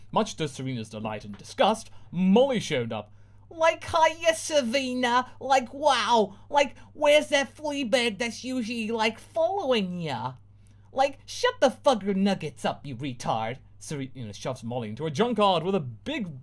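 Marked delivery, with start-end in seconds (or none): 3.5-13.6 put-on high, slow ditzy valley-girl voice. Ditzy friend greeting Serena, valley girl. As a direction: stretch every like.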